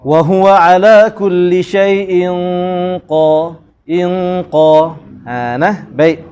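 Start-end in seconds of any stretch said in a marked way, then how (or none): 0.0-4.9 slow, drawn-out Quranic recitation; recitation demo. Shown as a demonstration. Teacher slowly recites the verse to students. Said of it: "Jadi kita baca".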